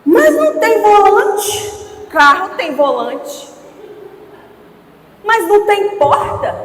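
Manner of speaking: put-on loud, high, drawn-out little girl's protest; whining child. Father imitating his daughter complaining about her wooden car, whiny child. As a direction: imitate an indignant kid, dragging the words out.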